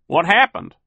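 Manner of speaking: high, loud, alarmed question; Phil. A narrator's reading. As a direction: ask high and startled. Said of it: "Phil asked".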